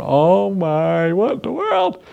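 rising into a high panicked cry; mock panic. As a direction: play a drunk king losing it in fright. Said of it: "He's losing it".

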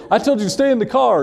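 high-pitched mock telling-off with a laugh; scolding mom. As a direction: imitate an exasperated mother. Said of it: "my mom was saying".

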